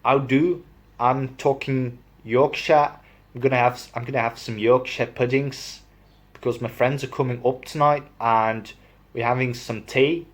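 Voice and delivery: Yorkshire with a hint of Scottish; attempted Yorkshire accent. Shown as a demonstration. Vlogger showing off Yorkshire accent, friends coming for tea. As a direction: have a rough go at Yorkshire. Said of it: "I'm not really good at it".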